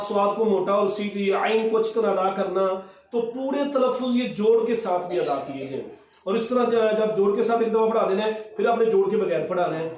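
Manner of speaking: usual speaking voice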